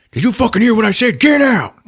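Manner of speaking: loud, high yell; hammed-up furious bark; acted angry order. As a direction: yell it at an underling, building to the last words. Boss orders lackey out of car.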